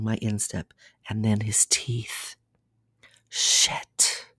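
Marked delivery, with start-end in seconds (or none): 3.3-4.3 a whispered, groaning "Shit"; half-whispered curse of pleasure; breathy groaned curse. A narrator's reading. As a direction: let the curse fall out on a breath. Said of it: "I groan".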